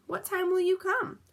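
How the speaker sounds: a small piggy voice asking; little pig asking the wolf when he will come. A narrator's reading. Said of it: "said the little pig".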